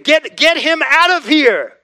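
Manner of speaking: loud, high mock crowd shout. Preacher voicing the crowd rejecting Jesus, loud and high. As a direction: yell it like the angry mob.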